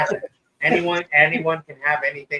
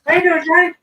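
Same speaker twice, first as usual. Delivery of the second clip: much higher, louder cheery greeter voice; mock visitors; 14 semitones higher, 4 dB louder than usual. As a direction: play eager fans dropping by. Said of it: "they're just gonna be like".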